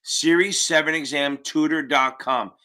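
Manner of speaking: slowed down, each word clear. Real, not acted. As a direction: spell it out slowly for them. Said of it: "I know I say it quick".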